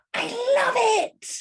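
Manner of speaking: high, squealed outburst; gleeful high-pitched cry; excited reveal. Acted. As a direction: burst out in drawn-out delight.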